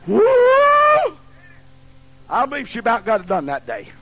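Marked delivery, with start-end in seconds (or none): 0.1-1.2 Woo!. Retold or imitated. Reacting to the beating.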